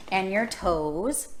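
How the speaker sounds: ordinary delivery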